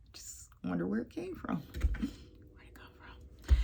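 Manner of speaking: slow puzzled whisper; hushed wondering aside. Real, not acted. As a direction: mutter it to yourself softly.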